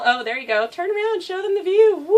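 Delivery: high, loud coaxing dog talk. Real, not acted. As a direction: coax the dog to turn. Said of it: "high-pitched baby voice".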